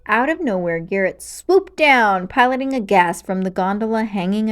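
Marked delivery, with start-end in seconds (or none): none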